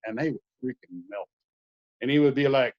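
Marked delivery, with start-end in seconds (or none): none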